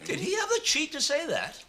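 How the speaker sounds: high-pitched outraged question; affronted squawk of disbelief; indignant. Acted. Writer hearing he is a lesser-known dramatist, indignant.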